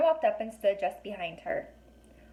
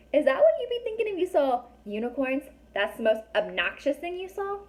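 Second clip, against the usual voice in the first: mock disbelief; 6 semitones higher than usual. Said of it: "mocking the character's word".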